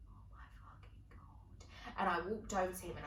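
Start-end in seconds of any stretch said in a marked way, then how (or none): 0.1-1.7 hushed, breathy under-the-breath whisper. Retold or imitated. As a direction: mutter it to yourself in horror. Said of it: "I was like".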